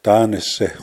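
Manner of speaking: "ta ne se" in three syllables; "ta ne se" said in full, nothing dropped; as spelt. Shown as a demonstration. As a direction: sound the middle vowel in "ta ne se". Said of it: "it's not even said like".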